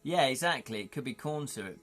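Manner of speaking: ordinary talk, nothing marked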